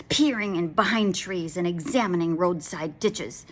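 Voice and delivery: plain reading voice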